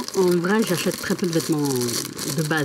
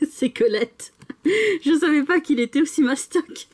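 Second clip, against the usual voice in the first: giggling, pitched up in delight; laughing surprise; 10 semitones higher than usual. Real, not acted. Vlogger unboxing a huge book, giggling and amazed. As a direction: laugh through the words, amazed.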